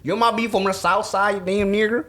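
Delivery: a bad Southern accent, shouted high; hammed-up Southern accent. Mock Southern rant after accent jab.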